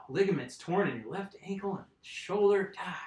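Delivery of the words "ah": usual speaking voice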